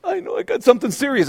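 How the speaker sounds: high, put-on hypochondriac voice; imitating a nervous patient; mock dread. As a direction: play the worrier sure of bad news. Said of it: "we're already freaking out".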